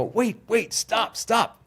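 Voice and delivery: high urgent calls to stop them. Retold or imitated. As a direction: call out to halt the stretcher in a hurry. Stopping the gurney in panic.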